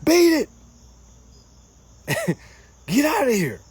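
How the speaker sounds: high, loud mock old-school brush-off; lightly voiced old-school tough guy shooing someone off; hammed-up beat it; old-timey brush-off. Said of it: "What they used to say back in the day".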